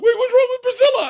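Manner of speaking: very high, loud mock wail; hammed-up child panic, pitched to the top; imitated upset kids. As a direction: shriek the question like alarmed kids. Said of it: "And then George and I were like".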